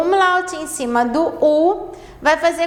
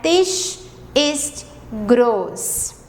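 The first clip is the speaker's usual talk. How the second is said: der Tisch ist groß, said very slowly and clearly; slow model sentence; much slower than usual. Shown as a demonstration. Teacher to Brazilian students, slow clear German model sentence. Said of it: "a primeira frase".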